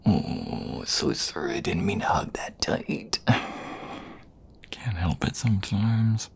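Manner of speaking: soft, close whisper to a lover; intimate whisper. Acted. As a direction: breathe the words right by their ear. Whispers an apology after hugging.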